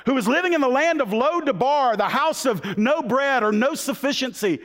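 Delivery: high-pitched, full-force sermon storytelling; fired-up preaching. Real, not acted. Preacher tells the church of the king's kindness, fired-up preaching. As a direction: preach it high and driving, line after line.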